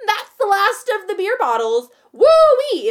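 high, loud, ditzy shout; high loud party-girl voice; loud drunk party girl. Retold or imitated. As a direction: yell it like a tipsy partier closing up. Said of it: "she loudly is like".

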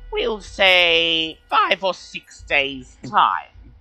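high, slow, formal little kobold. Acted. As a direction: speak as a polite high-pitched kobold. GM voices kobold.

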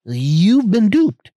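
drawn out, high and loud gotcha; spring the punchline slowly and loudly; mock dramatic reveal. Podcaster teasing listeners, slow loud mock reveal.

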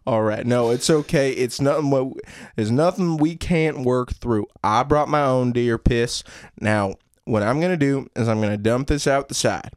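reassuring Southern father; steady the boy in a Southern accent. Acted. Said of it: "Southern accents?".

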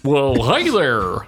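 slow, drawn-out goofy cowboy greeting; cheerful cartoon cowboy. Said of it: "He's just a goofy cowboy".

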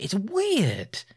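ordinary talk

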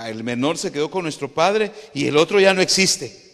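building to a shout; loud exclaimed quote. Acted. Brothers' claim to José retold, building loud.

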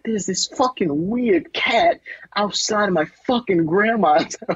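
high-pitched, worked-up viral-video rant; mock exasperated outburst; Michael Rappaport. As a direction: imitate an indignant guy complaining about a cat. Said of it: "Michael Rappaport ... where he's like".

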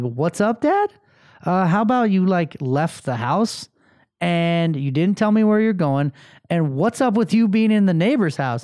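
re-enacted parental telling-off; incredulous dad. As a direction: throw it back in disbelief, pitch climbing. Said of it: "I'm like".

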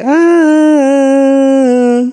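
high, loud mock lament; hammed-up sad little song; mock sad tune. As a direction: sing a short self-pitying wail. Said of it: "la cancioncilla es así".

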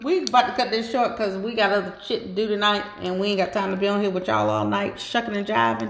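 ordinary talk

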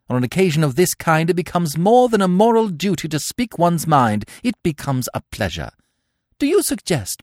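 high, loud, fiery tirade; indignant outburst. Acted. Cecily rounding on Gwendolen, loud indignant tirade. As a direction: let your temper fly.

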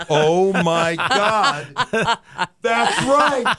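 high, laughing mock shock; laughing realization. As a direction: laugh through the sudden realization. Realizing he counts as elderly.

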